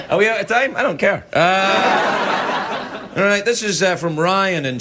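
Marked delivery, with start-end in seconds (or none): none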